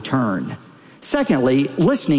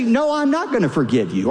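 higher-pitched imitated refusal; mock refusal; 8 semitones higher than usual. Preacher voicing someone refusing an apology, higher-pitched refusal. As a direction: play the offended party refusing, pitched up. Voices the offended person refusing.

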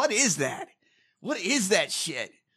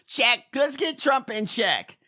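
ordinary delivery

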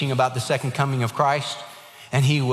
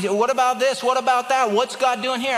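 higher, pressing questions; questioning people; 8 semitones higher than usual. Retold or imitated. Doubting people pressing the pastor with questions, raised pitch.

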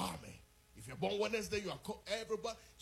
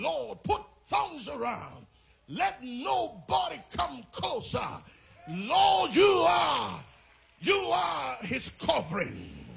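fervent loud prayer; 2 semitones higher, 11 dB louder, slower than usual. Retold or imitated. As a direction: cry out to God over him. Parents praying over son's bed.

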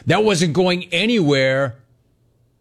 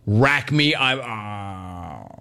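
slurring as he passes out; going under anesthesia; 4 semitones lower, much slower than usual. Pretend. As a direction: voice trailing off into sleep. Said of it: "jokes with the anesthesiologist".

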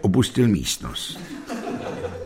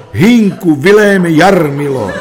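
high, loud verse recital climax; declamatory cry; 8 semitones higher, 9 dB louder, slower than usual. A narrator's reading. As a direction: cry out the names like a stage reciter. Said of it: "slečna s úderem dvanácté zvolala".

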